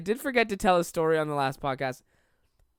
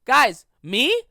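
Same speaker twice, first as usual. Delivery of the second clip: imitating startled friend; 7 semitones higher, 7 dB louder, much slower than usual. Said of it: "he went".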